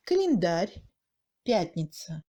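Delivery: "календарь, пятница" with A as mute e; strongly reduced A. Shown as a demonstration. Teacher showing second-degree reduction, "календарь, пятница" with faint А. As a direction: swallow the unstressed А in "календарь, пятница". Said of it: "Le A est très réduit".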